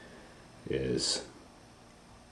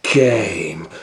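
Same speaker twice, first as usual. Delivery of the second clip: higher, louder monster reveal; dramatic build; 6 semitones higher, 6 dB louder than usual. A narrator's reading. Narrator to Leo, thrilling reveal of Cat Kong. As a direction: boom out the monster's entrance.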